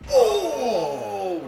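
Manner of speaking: sudden high yelp at a big move; excited shout. Real, not acted. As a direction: cry out at the big hit.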